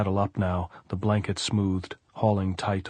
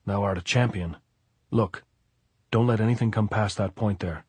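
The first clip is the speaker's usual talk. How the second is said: plain reading voice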